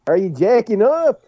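put-on deep South accent. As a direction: mimic the caller with a Southern accent. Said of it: "he's got a deep South voice".

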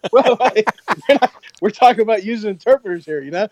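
high, flustered backpedalling; flustered backpedal. Retold or imitated. To the Marines, high flustered backpedalling. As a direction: laugh it off and steer back. Steering class away, startled.

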